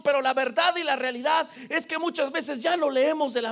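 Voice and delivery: high, loud preacher at full force; fervent sermon rising to shouts; impassioned preaching. Real, not acted. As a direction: preach it hard, pitched high and driving.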